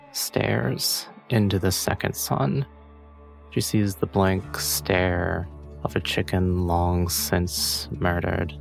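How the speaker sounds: usual speaking voice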